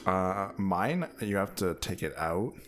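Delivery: normal talking voice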